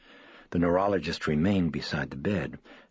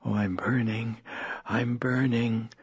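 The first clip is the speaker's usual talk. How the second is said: slow, higher moan of burning pain; 6 semitones higher than usual. Acted. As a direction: drag the words out, hurting. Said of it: "Regan was moaning".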